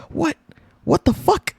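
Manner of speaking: high squeaky what the fuck; lightly mimicking the meme clip; meme impression. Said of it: "from the edits that people use".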